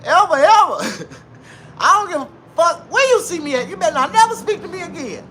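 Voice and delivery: put-on high, loud, furious old lady; angry old lady. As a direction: tell him off, fired up. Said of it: "She said".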